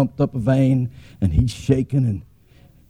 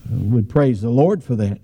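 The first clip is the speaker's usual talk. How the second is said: ordinary talk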